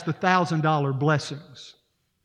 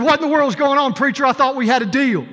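higher and louder, demanding; indignant outburst; 6 semitones higher, 5 dB louder than usual. Acted. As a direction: storm in protesting the broken deal. Groom bursts in, upset.

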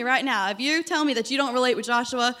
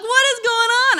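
high, louder bewildered outburst; shocked; 12 semitones higher, 8 dB louder than usual. Retold or imitated. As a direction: blurt out the disbelief. Retelling shock at dad's prayer.

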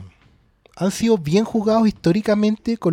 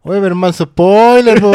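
loud complaint about a spoiler; loud protest; 3 semitones higher, 9 dB louder than usual. Real, not acted. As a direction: call out the spoiler loudly.

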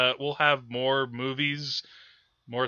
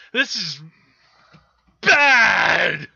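shouted verdict; 5 semitones higher, 7 dB louder, much slower than usual. Acted. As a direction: yell the word bad, much higher. Repeating verdict, then shouting it.

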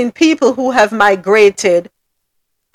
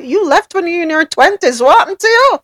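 incredulous; 8 semitones higher, faster than usual. Retold or imitated. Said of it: "And I'm like".

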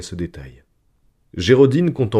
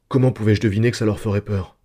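ordinary delivery

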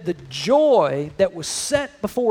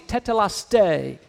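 usual speaking voice

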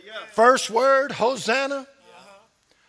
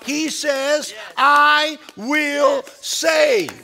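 rising, pounding sermon cadence; preaching at full force; 6 semitones higher than usual. Acted. Pastor preaching to church, building fervent refrain. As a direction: drive the refrain home to the congregation.

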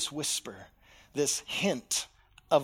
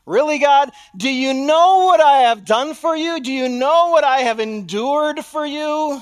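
much higher, louder, outraged pushback; exasperated believer arguing with God; indignant protest; 8 semitones higher, 13 dB louder than usual. Acted. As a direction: confront God with what you gave.

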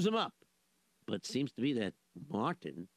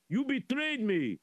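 pitched-up whiny lament; high wailing complaint; 13 semitones higher than usual. Acted. Zusha wails at betrayal.

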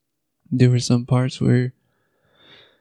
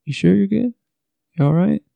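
gentle concerned check-in; 7 semitones higher than usual. Shown as a demonstration. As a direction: coax them gently to open up. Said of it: "always follow up with".

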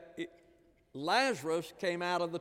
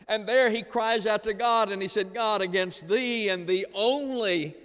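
loud, raised preaching cry; preaching at full force; 4 semitones higher, 7 dB louder than usual. Acted. Preacher crying out David's prayer to the congregation, loud. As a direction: lift it into a ringing sermon cry.

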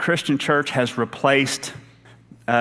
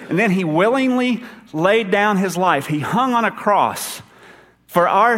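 higher, building preacher's voice, every line lifted; rising sermon fervor; 5 semitones higher than usual. Acted. Pastor to his congregation, summing up the gospel: fervent, higher preaching. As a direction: preach the gospel with mounting conviction.

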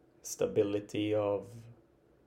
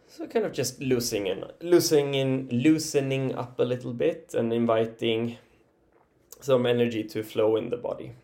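ordinary delivery

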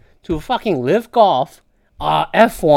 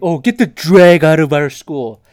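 loud mocking voice of a bigot; 2 semitones lower, 6 dB louder than usual. Parodying conservatives, a loud mock sneer. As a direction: sneer it out like an angry parent. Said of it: "it's like".

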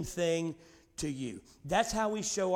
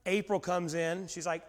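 normal talking voice

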